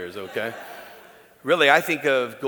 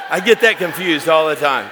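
laughing; 4 semitones higher, 12 dB louder than usual. Real, not acted. Laughs at his mixup.

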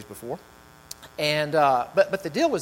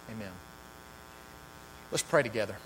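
ordinary delivery

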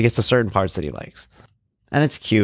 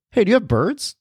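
curious support guy; 12 semitones higher than usual. Retold or imitated. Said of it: "he goes".

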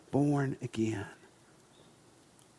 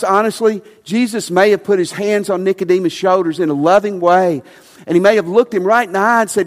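normal talking voice

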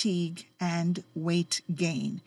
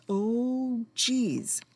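ordinary talk

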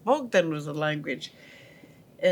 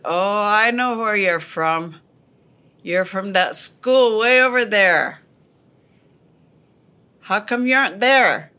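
farmer calling out; 3 semitones higher, 7 dB louder than usual. Retold or imitated. Farmer guesses they are from school.

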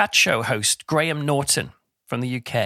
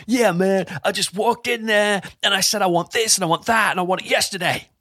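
boastful show-off; 6 semitones higher than usual. Retold or imitated. Mocking a bragger who walks in and demands things, cocky swagger. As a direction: puff yourself up like a bragging hero. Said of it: "they say things like".

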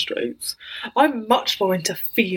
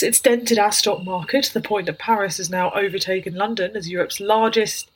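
ordinary talk, nothing marked

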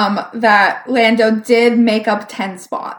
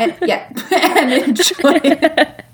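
higher, slow words through laughter; laughing; 6 semitones higher, slower than usual. Real, not acted. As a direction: repeat it while cracking up. Cracking up over coworker joke.

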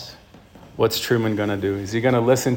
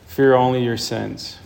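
normal talking voice

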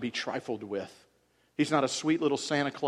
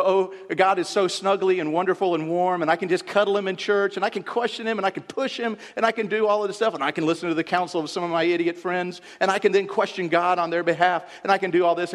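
higher, louder sing-song mockery of a naive believer; mocking naive believer; 6 semitones higher, 6 dB louder than usual. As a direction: parody the cozy believer. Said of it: "Job went with the".

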